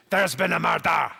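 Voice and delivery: shouted, slow Scottish murder line; Scottish accent. Retold or imitated. Comic doing a Scottish accent for the crowd, shouted. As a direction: bellow it like a Glasgow detective. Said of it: "the Scottish accent, probably the best phrase".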